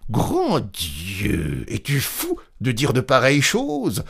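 rising, high-pitched scandalized warning; horrified disbelief. A narrator's reading. Apothecary reacting to a murder confession, horrified.

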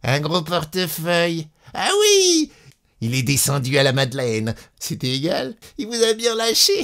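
high, hooting mockery ending in laughter; laughing conductor. A narrator's reading. Conductor laughing at the abandoned wife, mocking. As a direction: jeer at her, laughing. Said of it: "L'employé se mit à rire".

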